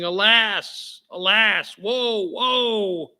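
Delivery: hammed-up cry of woe; mock lament. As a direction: wail it out like a mourning merchant. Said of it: "and again they're saying".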